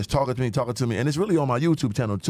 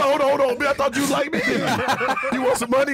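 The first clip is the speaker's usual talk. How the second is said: mock indignation; 13 semitones higher than usual. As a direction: play the offended guy who thought she liked him.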